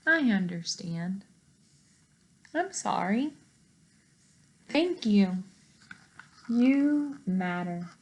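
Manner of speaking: plain reading voice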